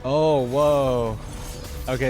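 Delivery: ordinary delivery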